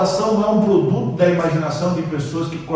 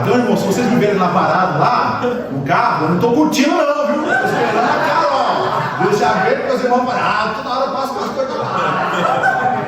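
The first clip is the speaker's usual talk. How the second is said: much higher and giggling, teasing the brothers; laughing, joking; 8 semitones higher than usual. Real, not acted. Preacher joking to the church that he is only waiting for Carol. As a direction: play it as a laughing joke.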